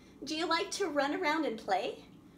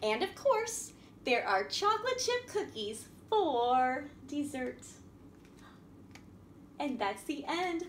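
put-on lively, sing-song storybook reading for little kids; reading to children; 4 semitones higher than usual. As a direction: read it like a picture book to a class. Reads a picture story to children.

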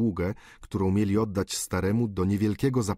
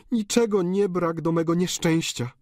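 high, desperate daughter's plea over a dying father; countess pleading; 9 semitones higher, 3 dB louder than usual. A narrator's reading. Countess begging her dying father for blessing, desperate high plea. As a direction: beg him to come back, voice high and torn.

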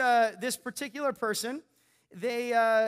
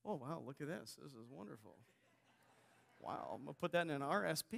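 mild mock calm reaction; 10 semitones lower, 14 dB quieter, faster than usual. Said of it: "what would you do if that happened?".

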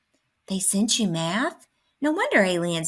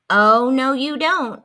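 loud protest; 3 semitones higher, 8 dB louder, slower than usual. Acted. Friend stops more math talk.

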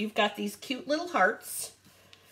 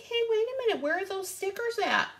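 higher-pitched, retold mild panic; mock freak-out; 9 semitones higher, faster than usual. Crafter retells missing stickers to viewers, mock freak-out. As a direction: play up puzzled alarm, pitched high. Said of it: "And I'm freaking out".

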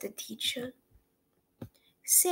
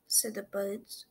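ordinary delivery, nothing marked

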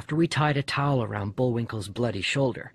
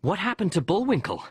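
higher-pitched, alarmed question; upset calling; 8 semitones higher than usual. A narrator's reading. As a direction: call out as an upset dad. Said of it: "Dad came in all upset".

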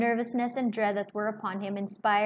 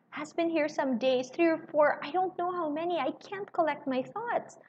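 distraught; 7 semitones higher than usual. A narrator's reading. Lucy distraught.

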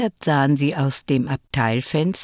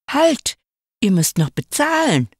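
hoch und lauter Ruf; laut hinterherrufen; rufend; 8 semitones higher, 3 dB louder than usual. A narrator's reading. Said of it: "hörten sie rufen". (lauter, hoher Ruf der Verkäuferin)

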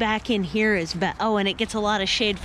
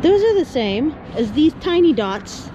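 raised, excited voice over noise; yelling over helicopter; 7 semitones higher than usual. Real, not acted. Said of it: "Sorry about the helicopter".

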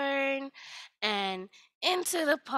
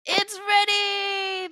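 higher, much louder, slow shout; triumphant shout; 11 semitones higher, 11 dB louder, much slower than usual. Acted. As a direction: call it out to everyone, slowly. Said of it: "the traveler said".

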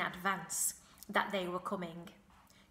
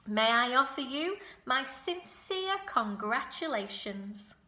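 formal announcement; 5 semitones higher, 7 dB louder than usual. A narrator's reading. Charlotte congratulating the goose before everyone, formal announcement. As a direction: deliver a grand public announcement. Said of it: "made the announcement".

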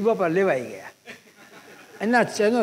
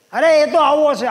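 sharp raised burst of anger; angry outburst; 7 semitones higher, 6 dB louder than usual. Retold or imitated. Old uncle scolds someone, angry loud outburst. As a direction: blurt it out high and loud in anger. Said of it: "ગુસ્સે થયા".

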